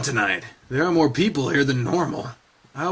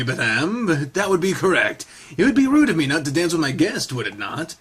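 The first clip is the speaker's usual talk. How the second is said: usual speaking voice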